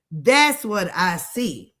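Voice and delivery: ordinary talk, nothing marked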